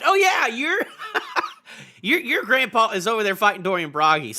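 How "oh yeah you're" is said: words dissolving into a big laugh. Real, not acted. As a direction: crack up while starting the sentence. Breaks into laughter mid-remark.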